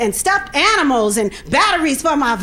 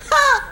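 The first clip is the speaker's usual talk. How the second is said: a sharp, high "Ha!"; high scoffing "Ha!"; scoffing laugh; 10 semitones higher than usual. Real, not acted. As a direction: bark out one high scornful laugh. Scoffing at mother's advice.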